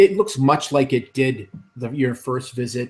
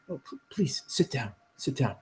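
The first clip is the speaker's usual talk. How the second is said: nervous soft wizard; 7 dB quieter than usual. Acted. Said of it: "he says".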